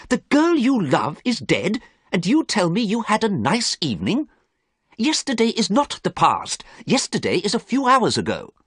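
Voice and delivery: appalled painter scolding; Basil, shocked. Acted. Basil rebukes Dorian for his nice evening, reproachful.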